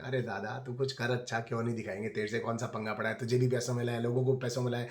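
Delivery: real quiet grumble to himself; exasperated mutter. Real, not acted. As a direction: mutter it under your breath in disbelief.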